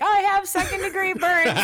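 a high, loud mock-panicked cry; panicked customer. Listener email quoted in a high, loud mock panic. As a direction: play the frantic customer reporting burns.

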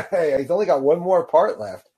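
normal talking voice

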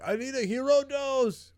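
high, loud mocking voice; teasing a friend about taking a huge dose; mock greedy user. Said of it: "are you gonna end up then being like".

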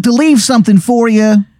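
high-pitched, earnest Baltimore character voice; bad Baltimore accent. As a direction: make a heartfelt speech in broad, high Baltimore. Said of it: "this Baltimore accent".

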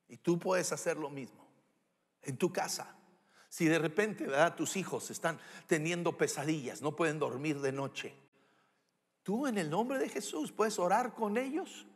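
ordinary delivery

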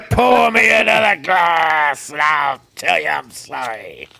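put-on drunken belted line. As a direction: belt it slow and sloppy. Drunken serenade.

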